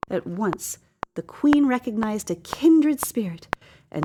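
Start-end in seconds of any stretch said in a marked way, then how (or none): none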